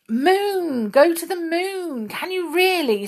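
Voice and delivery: put-on high, eager old man who misheard; excited old man. A narrator's reading. Deaf Saucepan mishears eagerly.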